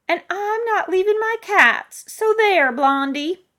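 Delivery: put-on high, loud, defiant old-lady retort; stubborn old woman. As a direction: snap back as a cranky elderly neighbor.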